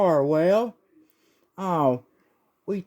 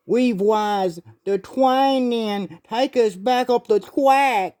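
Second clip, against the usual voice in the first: put-on higher, lisping baby voice; baby-talk Doc; 5 semitones higher, slower than usual. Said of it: "That's why he talks like a baby now".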